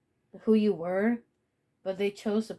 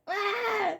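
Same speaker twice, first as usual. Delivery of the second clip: high, loud "ah!" through laughter; jump-scare yell, half laughing; mock scare scream; 5 dB louder than usual. Prankster jumping out of a bush, mock scream.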